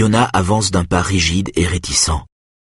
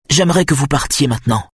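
curtly; 6 semitones higher than usual. A narrator's reading. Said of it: "dit-elle sèchement".

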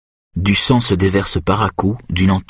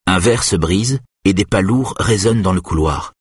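ordinary delivery, nothing marked

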